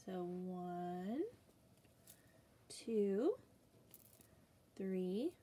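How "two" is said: ordinary delivery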